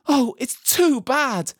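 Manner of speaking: put-on high, loud, slow girlish wail; sobbed. A narrator's reading. Lucy rushes to the empty table, sobbing. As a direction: wail it slowly, high in distress. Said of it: "sobbed Lucy".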